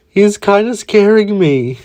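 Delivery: high, slow, nervous voice; scared. A narrator's reading. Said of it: "Minato would say".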